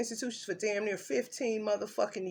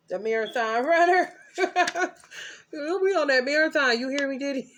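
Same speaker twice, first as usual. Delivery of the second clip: higher and louder, laughing through it; laughing shout-out; 8 semitones higher, 5 dB louder than usual. Real, not acted. Shouting out to [name] with a laugh, higher and louder.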